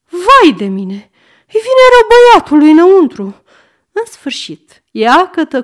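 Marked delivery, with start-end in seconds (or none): 0.1-3.2 loud, climbing to a fast high shriek; wail, then rush into frantic alarm; panicked cry. A narrator's reading. Grandmother panics at locked door. (strigăt de panică)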